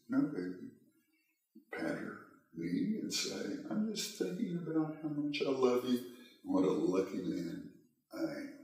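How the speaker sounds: hushed, sugary and tender, a touch high; soft, sweet reassurance. Retold or imitated. Husband patting his wife's knee, soft and honeyed. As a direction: sweeten it to hide the anger. Husband soothing wife, hiding anger.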